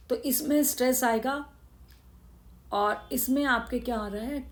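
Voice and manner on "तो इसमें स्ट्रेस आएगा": ordinary delivery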